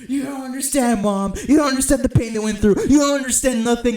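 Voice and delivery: high, loud mock outburst, partly sung; hammed-up rant at mom. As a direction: belt it at mom like a wounded fan. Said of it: "I was like".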